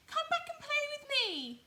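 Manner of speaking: very high, loud little rabbit call; squeaky loud pleading rabbit call. Acted. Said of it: "called Little Rabbit".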